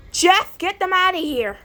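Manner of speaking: normal talking voice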